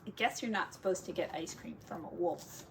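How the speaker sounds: plain reading voice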